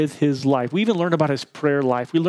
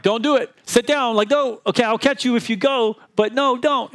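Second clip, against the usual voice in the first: high, urgent don't do it; father on the stairs begs toddler not to leap, high and urgent; urgent pleading; 8 semitones higher than usual. Retold or imitated.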